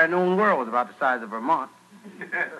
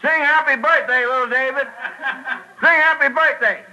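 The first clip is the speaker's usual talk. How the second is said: heckler's yell, high and loud; Goliath; 8 semitones higher, 5 dB louder than usual. Acted. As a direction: bellow the request at the performer. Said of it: "he starts yelling".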